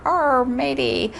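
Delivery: growly pirate greeting, drawn out. Pretend. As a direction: toss off a playful pirate "arr". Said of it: "So this is Talk Like a Pirate Day".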